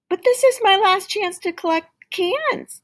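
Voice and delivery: put-on high, loud pleading boy; protesting child. A narrator's reading. Tim protesting to dad about the rain, high loud child voice. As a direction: protest like a kid who won't give up.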